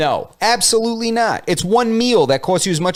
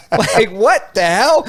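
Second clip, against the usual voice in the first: laughing; 3 semitones higher, much slower than usual. Real, not acted.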